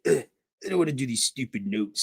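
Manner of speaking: mock-grumbling schoolboy, pitched a little up; grumpy pal. Schoolboy balking at doing class notes, mock grumpy. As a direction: lightly imitate a reluctant student's complaint. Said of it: "Ray'd be all grumpy pants".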